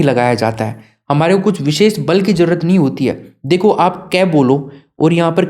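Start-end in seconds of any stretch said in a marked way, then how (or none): none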